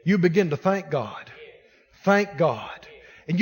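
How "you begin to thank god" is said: usual speaking voice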